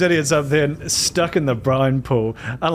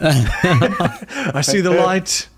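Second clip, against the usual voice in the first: very slow, higher, laughing; slow dying fish voice; 6 semitones higher, much slower than usual. Pretend. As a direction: drag it out like a fading fish.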